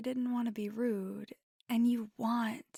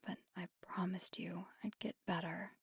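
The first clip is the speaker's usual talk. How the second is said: softer, subdued, with long pauses; quiet apology; 5 dB quieter than usual. Acted. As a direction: apologize gently and mean the promise. Apologizes and promises after the call.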